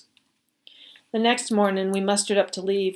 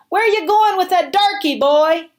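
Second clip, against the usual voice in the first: much higher, loud mocking soldier voice; hostile soldier yell; 10 semitones higher, 8 dB louder than usual. Confederate soldier yelling at the boy on the road, much higher and loud. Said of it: "one yelled at me".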